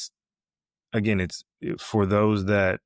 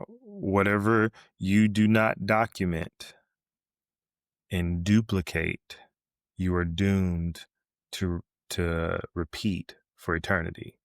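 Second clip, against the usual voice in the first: slow, deliberate restatement with long pauses; measured, spaced-out delivery for emphasis; slowly; slower than usual. Real, not acted. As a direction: say it slowly, phrase by phrase. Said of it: "Say that again, slowly".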